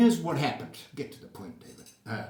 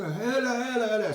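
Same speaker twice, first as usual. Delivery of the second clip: mock taunting; 9 semitones higher, 6 dB louder than usual. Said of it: "you go".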